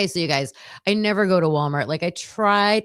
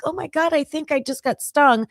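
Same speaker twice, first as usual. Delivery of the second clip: pitched-up alarmed "oh my God"; reliving the sting shock for a stranger; retold shocked gasp; 7 semitones higher than usual. Telling the stranger she got stung, mock alarm.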